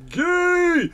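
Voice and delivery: loud, very high drawn-out shriek; hammed-up heckler's yell, pitch shooting up; mock yelling. Streamer imitating everyone in chat yelling out, mock shriek. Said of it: "I think yelling out".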